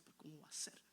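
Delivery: very soft, low near-whisper; hushed retold reply. Son answering his mother about hugging his father, hushed. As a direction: let it drop almost to a whisper.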